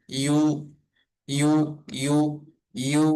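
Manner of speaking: ইউ glided gently, no hard attack; smooth, gentle y in ইউ; soft tone. Shown as a demonstration. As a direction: ease softly into each ইউ. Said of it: "নরম সুরে".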